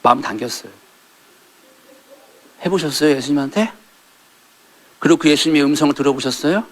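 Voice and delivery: usual speaking voice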